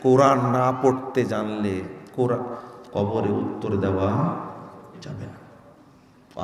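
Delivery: normal talking voice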